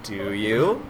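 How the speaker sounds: low, sceptical question; doubtful adult. Acted. Performer doubting a keen kid volunteer, low and sceptical.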